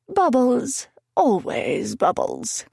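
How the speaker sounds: high, drawn-out, hammed-up dignity; high-pitched, dignified accent. A narrator's reading. Narrator choosing bubble bath, high-pitched dignified accent. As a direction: put on a high, stately accent. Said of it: "in a high-pitched, dignified accent".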